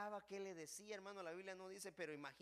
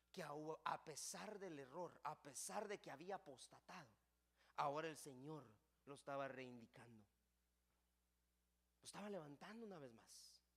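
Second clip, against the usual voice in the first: ordinary talk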